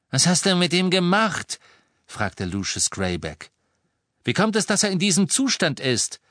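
agitated questioning. A narrator's reading. Agitated father questions the captor.

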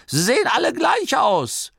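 high, loud, indignant granny; complaining granny. A narrator's reading. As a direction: protest in a high voice. (empörte, hohe Oma-Stimme)

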